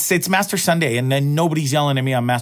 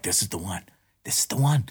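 hushed hopeful murmur; 7 semitones lower, 8 dB quieter than usual. Retold or imitated. Inner hope about lottery ticket.

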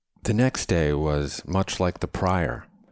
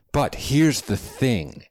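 high, louder, slow setup; Chadwick, impassioned; 9 semitones higher, 5 dB louder, slower than usual. A narrator's reading. Man continues his lecture to companion, emphatic. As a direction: make them wait for the point.

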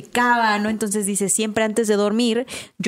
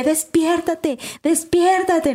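urgent call; 7 semitones higher, much slower than usual. Retold or imitated. Urgently waking her sleeping husband.

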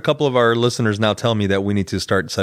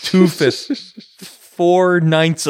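deliberately slow; 6 semitones higher, much slower than usual. Pretend. Joking slow correction.

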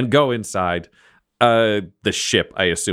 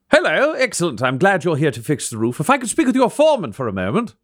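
lightly raised, courteous servant voice; high, polite butler; 9 semitones higher than usual. Acted. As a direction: greet them like a prim steward. Servant greets the roof workers.

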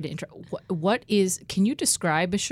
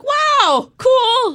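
high, loud burst of awe; childlike excitement; 9 dB louder than usual. Retold or imitated. Player recalls childhood geography game facts, reenacted excitement. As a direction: exclaim it like a thrilled kid. Acting out childhood delight at exports.

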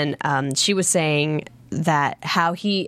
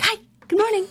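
very high, sweet imitation; Alexander Skarsgård; 12 semitones higher, faster than usual. Actor popping into the trailer offering coffee, high sweet greeting. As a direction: chirp it high, offering friendly help. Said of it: "he's just so sweet".

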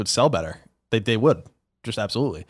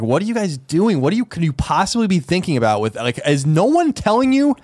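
annoyed disbelief; 7 semitones higher than usual. Retold or imitated. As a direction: let the pitch climb in frustration. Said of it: "I just get so annoyed sometimes".

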